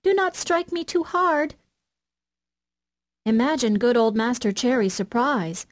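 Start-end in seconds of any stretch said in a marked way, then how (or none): 0.0-1.6 high thin little voice from the wood; a tiny voice crying out from inside the stick; thin sharp voice. A narrator's reading. The stick of wood cries out to the carpenter, high and thin. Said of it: "he heard a thin, sharp voice cry out".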